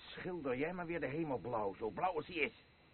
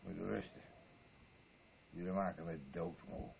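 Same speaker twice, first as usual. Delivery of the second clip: usual speaking voice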